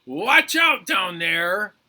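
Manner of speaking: high, very loud warning yell. Acted. Arms warning Head from up the tree, yelling. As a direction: holler it down from up in the tree. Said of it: "Head heard someone yelling".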